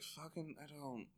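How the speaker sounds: mock sulky mumble; grumbling retreat. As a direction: mumble as you back off. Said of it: "they're like".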